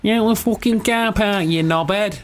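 high, drawn-out mock Manchester; Manchester accent. Host parodies Joel's slip, high Manchester accent. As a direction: exaggerate a mouthy Manchester accent. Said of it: "That sounded like Manchester at the end".

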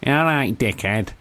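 mock Mancunian through the nose; nasal Manchester accent. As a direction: imitate a nasal Mancunian greeting. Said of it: "Real nasal".

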